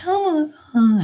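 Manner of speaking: very high little puppet voice; Elmo impression. Uncle entertaining his nephews, squeaky puppet impression. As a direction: squeak it like a furry kids' puppet. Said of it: "I used to do his voice and shit".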